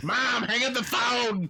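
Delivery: high, drawn-out yell at mom; kid yelling. Retold or imitated. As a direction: call out like a whiny kid. Kid yelling at parent on phone.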